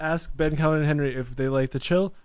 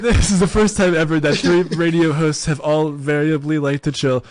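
loud mock breaking-news excitement; excited announcer; 2 semitones higher, 6 dB louder than usual. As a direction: announce it like historic live radio news.

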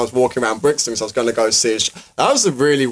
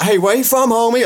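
higher-pitched, hammed-up American accent; put-on American greeting, pitched up; bad American accent; 9 semitones higher than usual. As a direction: imitate an American, badly. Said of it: "some really bad American accent".

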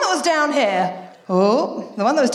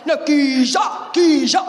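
"geezer" shouted louder in a London accent; louder cockney "geezer"; 3 dB louder, slower than usual. Shown as a demonstration. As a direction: bark "geezer" like a London bloke. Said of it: "He's a proper fucking geezer".

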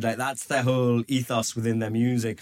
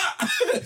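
sudden high laugh; high burst of laughter. Real, not acted. Comic laughing at the audience's confused reaction.

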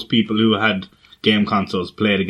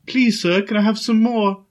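higher, meek begging voice; a small boy begging for food; pleading orphan; 9 semitones higher than usual. Retold or imitated. Workmate's quote, higher meek begging. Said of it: "one guy at work said".

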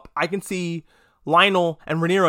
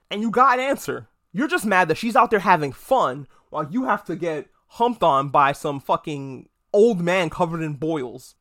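ordinary talk

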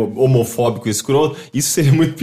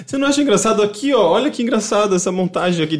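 high, fast mock boss voice; hammy boss showing off a meme; 8 semitones higher, faster than usual. Imita o chefe mostrando montagem. (chefe debochado, voz aguda e rápida)

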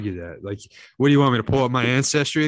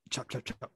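whispered chop chop chop; hushed chopping mimic; chopping sound; 12 dB quieter than usual. Imitating a blade chopping.

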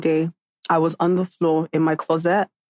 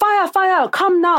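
high, pressing imitation of a caller; retold urgent alarm; 12 semitones higher, slower than usual. Manager summons the owner to a fire, imitated urgently. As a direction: urge it in a raised mock-alarm voice.

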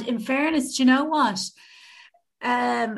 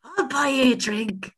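higher, put-on Dublin voice; Dublin accent; 7 semitones higher than usual. Offering to buy someone a drink, Dublin accent. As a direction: make a mock offer in broad Dublin. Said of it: "doing this in a dub accent".

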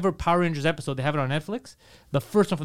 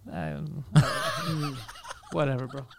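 indifferent shrug; 4 semitones lower, 6 dB quieter, slower than usual. Retold or imitated. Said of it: "Power Rangers are kinda like".

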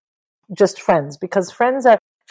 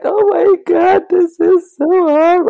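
put-on whiny child; 11 semitones higher, 5 dB louder, slower than usual. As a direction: imitate the youngest whining about the sad book. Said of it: "the baby's like".

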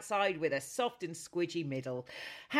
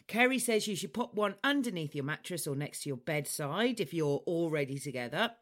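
ordinary talk, nothing marked

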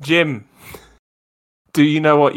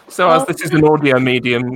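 normal talking voice

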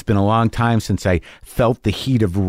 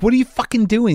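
agitated confrontation; 12 semitones higher than usual. Retold or imitated. Walked up to him in the hallway, agitated. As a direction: confront him, voice riding up high.

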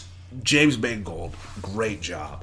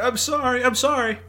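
much higher, frail imitated old man; imitating Xavier; 13 semitones higher than usual. Retelling the dying mentor's apology, a high frail imitation. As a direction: squeak out a feeble apology in imitation. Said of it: "And he's just like".

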